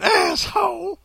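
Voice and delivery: high-pitched cartoon maid; hammed-up high character voice; put-on maid voice. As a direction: flip up into a mock old-lady voice. Repeats the word in put-on voice.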